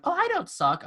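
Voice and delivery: high whiny mock of someone insisting; mock defensive whine. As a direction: squeak it like a silly self-defender. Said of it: "when somebody goes like".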